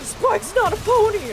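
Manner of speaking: high, loud indignant outburst imitating a fan; mock outraged yell; pissed-off correction. Irritated brony customer correcting her about Spike, loud indignant shout. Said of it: "and he would get pissed".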